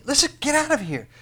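urgent command. Acted. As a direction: push them to flee now. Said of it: "God is, is saying".

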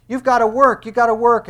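high, loud insistence; demanding. Acted. Other faiths telling people to work, loud demanding. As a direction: hammer it out as a demand. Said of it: "All others are saying".

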